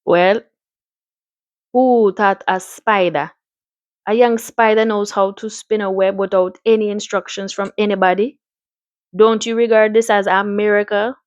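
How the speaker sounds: put-on lilting Caribbean-accented doctor. Doctor pondering the spider's web, Caribbean accent. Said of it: "I'm gonna give him a Caribbean accent".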